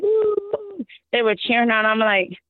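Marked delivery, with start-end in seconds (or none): 0.0-0.8 mock crowd cheer. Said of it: "And when the crowd was like".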